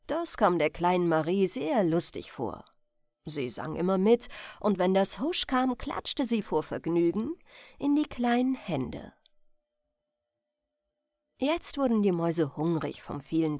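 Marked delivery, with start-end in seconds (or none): none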